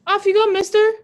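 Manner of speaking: high, loud showy wizard farewell; grand send-off. Acted. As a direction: send him off like a theatrical wizard. Wizard figure sends him off.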